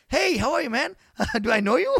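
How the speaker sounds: high, laughing puzzled reply. Retold or imitated. Greeting a stranger on the stairs, laughing reply. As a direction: laugh through the confused hello. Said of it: "I'm like".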